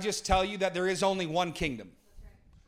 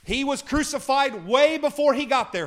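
preaching shout; 6 semitones higher, 7 dB louder than usual. Real, not acted. Preacher building to a climax.

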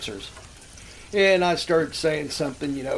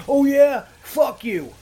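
higher, louder mock-angry retort; retold mad comeback; 8 semitones higher, 5 dB louder, slower than usual. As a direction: imitate a man who keeps getting madder. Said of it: "getting madder and madder and go".

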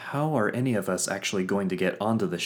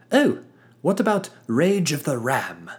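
eager showman; 8 semitones higher, 8 dB louder than usual. Acted. Fitz bouncing back eagerly.

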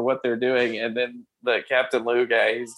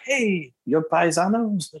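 slow, higher vintage cartoon plumber voice; old cartoon Mario voice; 4 semitones higher, slower than usual. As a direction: drawl it like a retro cartoon Italian plumber. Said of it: "like, the old cartoon voice".

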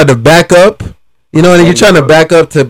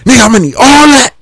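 higher, fast, imitating a mad driver; mock angry threat; 9 semitones higher, much faster than usual. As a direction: rattle off the demand like the angry stranger. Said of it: "he started getting mad".

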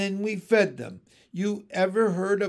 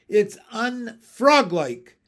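loud, slow, higher "unfroglike"; disapproving emphasis; 5 semitones higher, 10 dB louder, slower than usual. Acted. Frog wife judges the froglet family, "unfroglike" slow and loud. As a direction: stretch out "unfroglike" with raised pitch.

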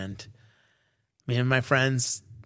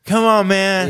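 louder, higher retold drunken nagging; drunk pleading; 5 semitones higher, 5 dB louder, slower than usual. Shit-faced customer pestering the bartender for a drink, loud mock pleading. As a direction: play a drunk badgering the bartender. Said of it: "I proceed to get shit-faced".

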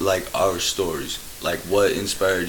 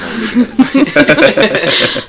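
laughing; 18 dB louder, much slower than usual. Real, not acted. Laughing at own story.

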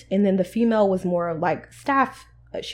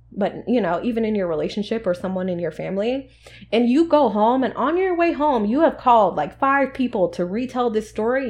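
ordinary delivery

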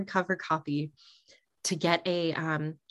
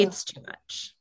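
whispered; 7 dB quieter than usual. Real, not acted. Overwhelmed by torture talk.

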